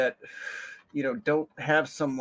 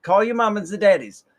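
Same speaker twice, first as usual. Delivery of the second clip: motherly coworker; 6 semitones higher, 5 dB louder than usual. Retold or imitated.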